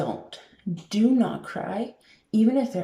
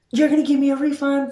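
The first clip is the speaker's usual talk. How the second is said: loud, fast mock yell at a cashier; imitated angry yell; 8 semitones higher, 6 dB louder, faster than usual. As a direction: play the furious customer shouting her demand. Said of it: "starts yelling at you".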